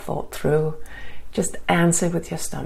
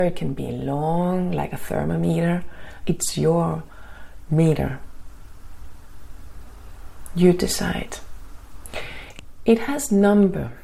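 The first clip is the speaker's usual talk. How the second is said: guided meditation. Acted. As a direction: ease them into calm, gently.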